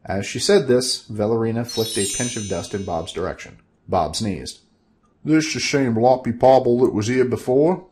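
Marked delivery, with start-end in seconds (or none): 5.2-7.9 louder, lightly voiced suspicious herald; a hint of a doubtful courtier; Gobblesniff's voice. Gobblesniff asking if Bob is the same, mildly suspicious herald.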